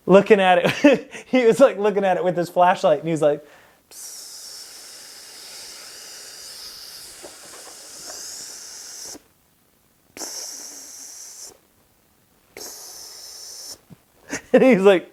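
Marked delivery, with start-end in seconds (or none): 3.9-13.8 very quiet repeated hissing; long hiss first, then shorter bursts with pauses; imitated hiss. Imitates Galvano examining the leak.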